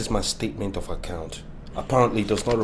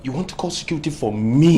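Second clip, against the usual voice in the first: louder outraged disbelief; incredulous, louder; 6 semitones higher, 8 dB louder than usual. Acted. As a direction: throw it back at her.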